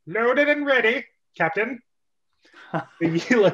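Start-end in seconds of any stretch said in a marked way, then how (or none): 0.1-1.8 higher, crisp soldier voice; soldier report. Acted. Lieutenant telling the captain it's ready.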